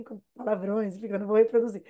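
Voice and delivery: ordinary talk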